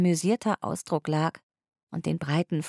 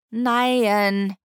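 drawn-out, louder sulky no; whiny refusal; 5 semitones higher, 5 dB louder than usual. A narrator's reading. Polly refusing to go to the meeting, drawn-out whiny no. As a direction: stretch the no like a stubborn child.

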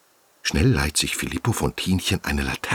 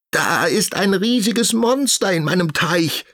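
very high panicked frog voice; frightened cry. Acted. Frightened frog crying out about the monster in his pond. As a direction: cry out the alarm. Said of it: "schreit er".

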